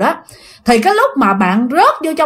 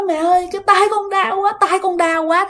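put-on crying child; 7 semitones higher than usual. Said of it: "bạn khóc ré lên".

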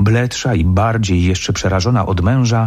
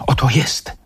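raised, mildly excited cry; higher, eager exclamation; exclaiming; 5 semitones higher than usual. Acted. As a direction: call out the discovery with a touch of joy. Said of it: "zawołałem".

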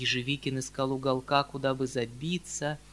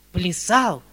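horrified; 5 semitones higher, 11 dB louder than usual. A narrator's reading. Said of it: "вскрикнул в ужасе дядя".

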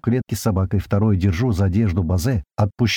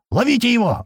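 high-pitched urgent yell; yelled command; 12 semitones higher than usual. A narrator's reading. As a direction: call out to catch him, pitch leaping up. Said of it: "орёт Слива".

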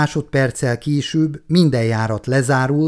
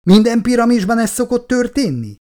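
alarmed; 10 semitones higher, 4 dB louder than usual. A narrator's reading. Walls move, panicked question.

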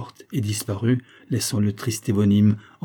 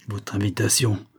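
ordinary delivery

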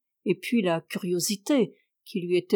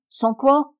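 "Sans quoi?" thrown back higher and louder, threatening; menacing; 5 semitones higher, 5 dB louder than usual. A narrator's reading. Uncle threatening his nephew, menacing "Sans quoi?". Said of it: "menaçant".

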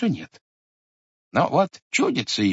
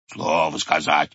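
bear roar; 8 semitones lower than usual. Acted. Bear echoing grandfather, low growl. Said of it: "ревнул медведь".

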